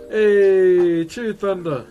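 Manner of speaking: loud call-out. Real, not acted. Streamer loudly greets a viewer, Thunder, who cheered.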